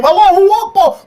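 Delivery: high shouted battle cry; mock scream. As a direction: imitate the cry, pitched high.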